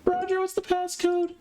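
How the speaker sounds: very high squeaky voice; playful squeak. Pretend. Streamer asking Roger for passcode, playful squeak. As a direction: ask it in a silly high squeak. Playfully repeating question while waiting.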